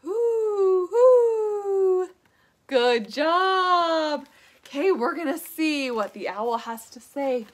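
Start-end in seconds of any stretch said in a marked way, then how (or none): 0.0-2.1 long, drawn-out high owl hoots; imitated owl call. Shown as a demonstration. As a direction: hoot like an owl for the children. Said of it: "What does an owl say?".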